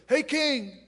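high, breezy "hey king"; casual David. Retold or imitated. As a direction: toss it up to the king lightly. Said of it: "and David goes".

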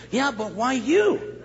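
acted incredulous mother; doubting mother. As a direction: push back slowly, unimpressed. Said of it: "she says".

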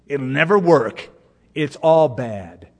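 lightly voiced gloomy naysayer; a hint of a defeatist, dragging out the last words; pessimist. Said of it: "This is the view of a pessimist".

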